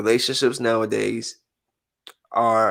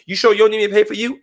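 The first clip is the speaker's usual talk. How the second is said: rapid teasing check; 7 semitones higher, much faster than usual. Retold or imitated. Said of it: "I'm like".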